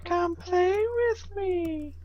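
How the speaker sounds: put-on high, slow, eerie child's call; ghostly voice. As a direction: lure them in like a ghost child. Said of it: "Ghostly voice in the mind?".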